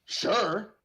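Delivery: usual speaking voice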